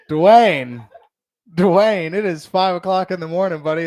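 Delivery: loud, stunned calling his name twice; shocked exclaim. Retold or imitated. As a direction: recoil at the shocking line. Shocked reaction to coworker's confession.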